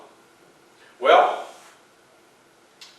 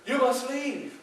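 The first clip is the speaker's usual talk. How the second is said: wife's plea; 7 semitones higher, 6 dB quieter than usual. Retold or imitated. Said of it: "she says".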